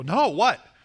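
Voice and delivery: high, loud imitated demand; eager insistence. Women pressing him to ask their opinion, eager. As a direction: insist on being told.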